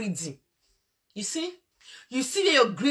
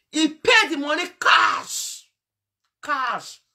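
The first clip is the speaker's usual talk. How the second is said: indignant shout; 4 semitones higher, 5 dB louder, slower than usual. Real, not acted. Outrage at the money paid.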